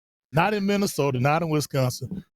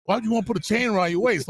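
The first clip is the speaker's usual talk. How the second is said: quick, higher-pitched baffled question; confused; 6 semitones higher, faster than usual. Retold or imitated. Retold store clerk questioning an odd customer, mildly confused and quick. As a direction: imitate someone totally puzzled, words rushing out. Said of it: "he's confused as hell".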